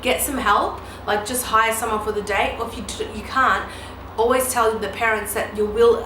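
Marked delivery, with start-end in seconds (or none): none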